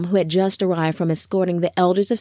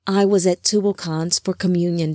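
usual speaking voice

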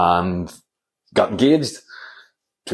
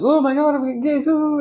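squealing high gushing announcement; hammed-up giddy fiancée; high girly mock squeal; mock excited sister. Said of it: "she's all like ... she's all happy".

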